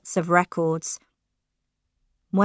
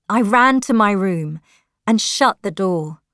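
plain reading voice

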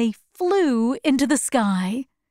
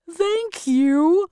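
high-pitched, grateful Scarecrow; warm thanks; 7 semitones higher than usual. Acted. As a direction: thank them warmly in a raised voice. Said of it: "the Scarecrow said warmly".

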